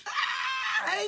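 high excited cry; excited shout. Real, not acted. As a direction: call out in delight. Greeting shout.

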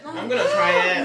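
laughing. Real, not acted. Keen to taste the fish.

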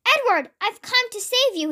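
high, heroic storybook call. A narrator's reading. Flynn arrives to save Edward, calling out high. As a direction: call up high to someone in need. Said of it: "he called".

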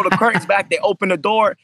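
usual speaking voice

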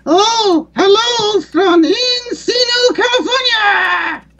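very high, drawn-out ventriloquist dummy voice; slow, piercing distant-puppet call, stretching every word; Bob impression. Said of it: "going to do an impression of Bob".

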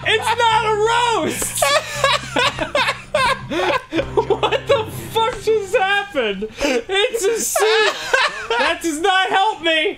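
laughing hysterically. Real, not acted. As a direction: crack up while shouting it. Game goes wrong, cracking up.

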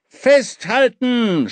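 slow, high, loud shout. Acted. King yells to the boy to hold on tight as he pushes off. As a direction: yell to hold on. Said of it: "schrie er".

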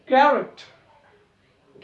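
Character slow and loud, KA punched; character very slowly with KA stressed; first syllable lifted. Shown as a demonstration. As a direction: stretch character and hit KA. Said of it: "पहिल्याला उचल त्याला".

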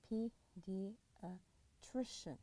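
Pediatrician said slowly, the voice lifting on tri; stress on tri. Shown as a demonstration. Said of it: "فاللي قبل الأخير حيكون عليه هو ستريس".

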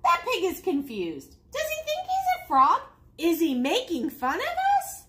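affronted frog chatter; indignant frogs. Acted. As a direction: pitch it up in offended disbelief. Indignant frogs react to the pig.

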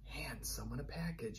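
soft unvoiced whisper; hushed pretend handoff. Shown as a demonstration. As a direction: whisper it like a secret. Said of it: "We could pretend to".

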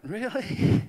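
incredulous really. Retold or imitated. Surprised at God's topic.

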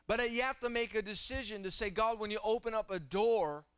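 high-pitched, driving sermon delivery; impassioned preaching. Real, not acted. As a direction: preach it with mounting fervor. Preaches at rising force.

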